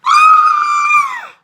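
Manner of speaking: shrill, full-throated shriek of fright; scream. Acted. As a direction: scream like a frog just landed on your face. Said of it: "She screamed again".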